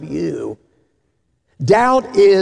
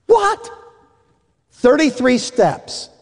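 high squeak of disbelief, then slow; hammed-up gasp of disbelief at the number; stunned weatherman, pitched way up; shocked; 7 semitones higher, slower than usual.

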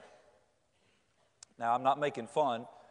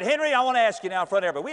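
fast call-out; 7 semitones higher, 6 dB louder, much faster than usual. Retold or imitated. Preacher calls out to teen.

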